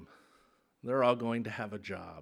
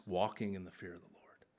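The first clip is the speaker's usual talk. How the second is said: whisper; 6 semitones lower, 11 dB quieter than usual. Acted. Quoting scripture, whispered for emphasis.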